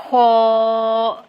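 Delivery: خا with a scraped throat kh, held long; drawn-out, scraped خا; throat-scraping kha. Shown as a demonstration. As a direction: rasp the kh of خا and sustain the vowel. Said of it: "گلے میں آپ لوگوں کے خراش ہوگی".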